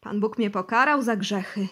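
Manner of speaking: loud, heartfelt lament; with feeling. Acted. Bosy laments to the interrogators, loud with feeling. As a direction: lament his sins with emotion. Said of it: "ciągnął z uczuciem".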